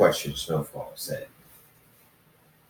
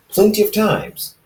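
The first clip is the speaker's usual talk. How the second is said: annoyed; 13 semitones higher, 4 dB louder than usual. Acted. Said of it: "Ethan rolled his eyes in annoyance".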